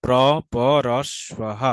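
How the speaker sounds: প্রপরশ্বহ very slowly, pra-pa-ra-shva-ha; slow teacher's breakdown of প্রপরশ্বহ; syllable by syllable. Shown as a demonstration. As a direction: spell out প্রপরশ্বহ by ear, one drawn syllable at a time. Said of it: "সেটাকে বলে প্রপরশ্বহ".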